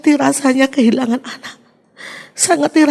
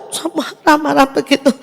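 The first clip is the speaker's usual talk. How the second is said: ordinary delivery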